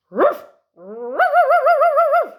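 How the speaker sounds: high, loud yapping woof sounds; a dog barking back at the duck. Acted. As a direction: bark like an excited little dog. Dog barks in story.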